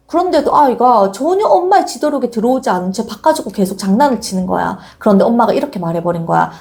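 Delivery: ordinary talk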